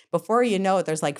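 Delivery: ordinary talk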